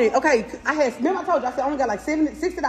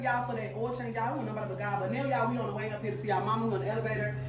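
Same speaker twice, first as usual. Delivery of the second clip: hushed; 3 semitones lower, 11 dB quieter, faster than usual. Real, not acted. Talking low in the elevator.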